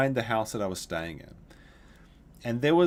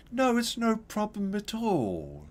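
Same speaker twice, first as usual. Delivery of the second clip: high, slow, theatrical greeting; flamboyant old neighbor; 10 semitones higher, slower than usual. Retold or imitated. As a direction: drawl it out like a flamboyant old man. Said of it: "the way the guy said it was so flamboyant".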